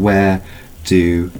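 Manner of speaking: ordinary talk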